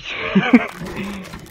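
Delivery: high laughter after a win; triumphant laugh. Real, not acted. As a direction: let a delighted laugh trail on. (risa aguda de triunfo)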